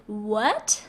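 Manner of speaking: "What?" with a questioning rise; second tone. Shown as a demonstration. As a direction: let "What?" climb steeply like an astonished question. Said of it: "let's give it a second tone, like when you say".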